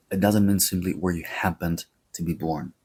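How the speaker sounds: normal talking voice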